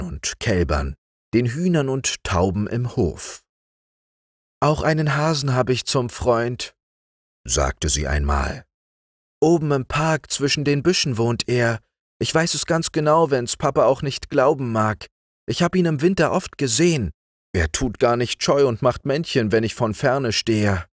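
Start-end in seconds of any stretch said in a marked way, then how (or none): 4.6-6.7 put-on higher-pitched child voice; high, childlike storytelling voice; little girl. A narrator's reading. Little patient chatting about her hare. 9.4-20.9 put-on high, lively child voice; chatty child, pitched well up; little girl. A narrator's reading. Little girl insisting about her hare.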